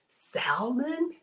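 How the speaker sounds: "salmon" as a rising question, L sounded. Retold or imitated. Host testing how a viewer says it should be, salmon with the L. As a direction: try out "salmon" with a clear L, questioningly. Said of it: "I don't pronounce the L".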